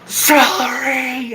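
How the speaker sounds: long, high holler; drunken-style yell. Shown as a demonstration. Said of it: "I just yell at the top of my lungs".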